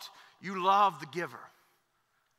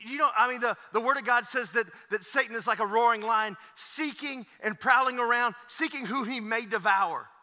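louder, higher preaching about the roaring lion; full-force preaching; 3 semitones higher, 5 dB louder than usual. Acted. Preacher warning congregation about Satan the lion, rising full-force preaching. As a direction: build the sermon like a warning.

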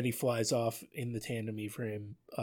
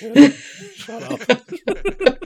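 high, laughing mock exasperation; a hammed-up weary "oh, shut up"; eye-rolling dismissal; 13 semitones higher, 11 dB louder, much slower than usual. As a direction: roll your eyes and brush him off. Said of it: "you could hear Brodsky rolling his eyes".

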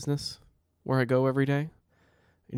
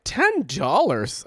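mock disbelief; 8 semitones higher, 4 dB louder, much slower than usual. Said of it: "I'm like".

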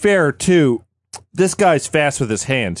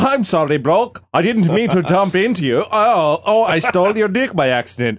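mock German accent, pitched up; higher, comic German-accented apology; 4 semitones higher than usual. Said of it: "he's German".

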